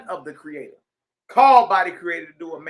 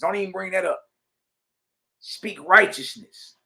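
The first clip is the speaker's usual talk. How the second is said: ordinary delivery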